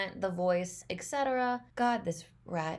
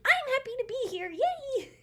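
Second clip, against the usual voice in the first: much higher, bright squeaky cheer; chirpy eager contestant. Pretend. Voicing a cheerful auditioner, squeaky high cheer. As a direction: squeal it like an overjoyed cartoon critter. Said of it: "this guy's just like".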